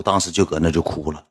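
normal talking voice

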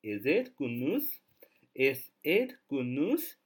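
slow demonstration question; slow model question. Shown as a demonstration. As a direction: read Is it good news? slowly with a rise. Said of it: "完整的句子应该是".

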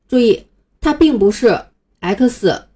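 ordinary delivery

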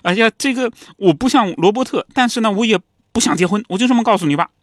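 a son squirming, tripping over his words; embarrassed. Retold or imitated. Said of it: "感到很尴尬".

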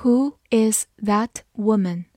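slow, careful question; slow reading. Shown as a demonstration. Teacher reading the question slowly for students. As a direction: ask it slowly and clearly. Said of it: "好，慢读一遍。".

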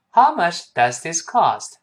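slow, deliberate model reading; full model sentence. Shown as a demonstration. Teacher leads the whole sentence once, slow and deliberate. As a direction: say it whole, slowly, every word placed. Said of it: "我们完整的来一遍".

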